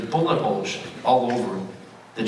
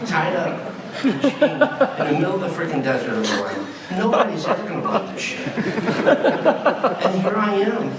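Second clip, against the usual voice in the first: laughing through the words; real laughter breaking in; 6 semitones higher than usual. Real, not acted. Retelling the hostage joke to the audience, laughing.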